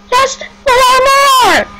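slow pleading yell; desperate cry. Real, not acted. Pleading for more kills.

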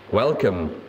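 usual speaking voice

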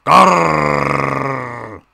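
hammed-up growl; villain growl. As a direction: growl like an angry engine.

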